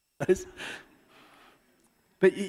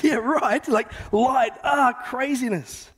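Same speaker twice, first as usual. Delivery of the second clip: incredulous shepherd; 8 semitones higher, 3 dB louder, slower than usual. Acted. Shepherd's scared reply.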